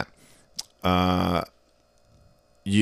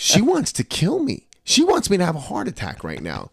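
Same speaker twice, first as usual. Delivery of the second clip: exasperated aside; 9 semitones higher than usual. Retold or imitated. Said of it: "I'm like".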